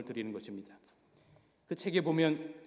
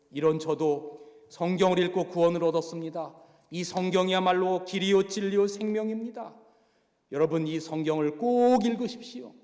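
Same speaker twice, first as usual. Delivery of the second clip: preaching; 3 semitones higher, 5 dB louder than usual. Retold or imitated. Go Jae-bong preaches to inmates.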